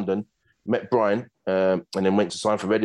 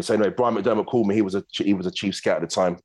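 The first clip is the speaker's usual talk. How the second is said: normal talking voice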